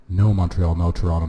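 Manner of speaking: usual speaking voice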